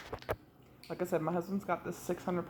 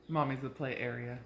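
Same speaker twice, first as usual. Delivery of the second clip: normal talking voice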